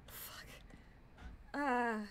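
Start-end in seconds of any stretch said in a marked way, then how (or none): 0.1-0.4 breathless whispered swear; whispered so the ghost won't hear; whispered curse. Real, not acted. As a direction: hiss it under your breath. Tense ghost hunt, whispered curse.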